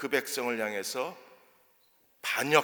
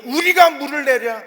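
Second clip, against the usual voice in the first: angry cry; 11 semitones higher, 10 dB louder than usual. Acted. Moses' words quoted loud.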